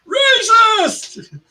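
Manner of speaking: mock shout. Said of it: "jumps in and says".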